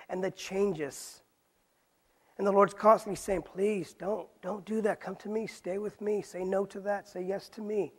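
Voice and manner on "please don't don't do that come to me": ordinary talk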